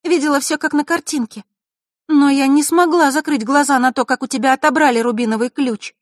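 put-on a sad girl turning firm; girl voice with steel. A narrator's reading. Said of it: "В голосе девочки прозвенел металл".

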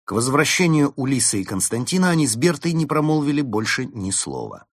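usual speaking voice

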